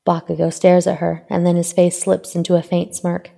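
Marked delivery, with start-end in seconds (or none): none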